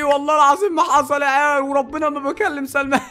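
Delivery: high, loud protest; indignant denial. Real, not acted. Prankster denying to viewers any chats with Salma, loud protest. Denies brother's accusation before viewers.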